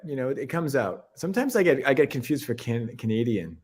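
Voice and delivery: ordinary talk, nothing marked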